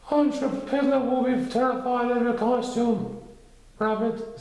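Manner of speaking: high bear voice, a touch sad; sad Pooh. A narrator's reading. Pooh sadly telling Rabbit about Piglet, high and mild. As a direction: say it gently and sorry. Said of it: "said Pooh sadly".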